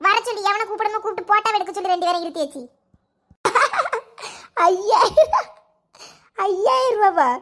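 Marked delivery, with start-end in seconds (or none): 4.7-7.3 shocked at the price. Acted. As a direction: scoff at ஐயாயிரம் and say it again in disbelief. Mother hears the photo price. (விலையைக் கேட்டு சிரிப்புடன் அதிர்ச்சி)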